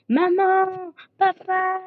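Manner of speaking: high loud call for mum and dad; yelling for mum and dad. Acted. Child calling out to parents.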